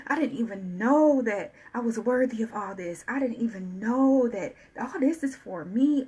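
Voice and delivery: usual speaking voice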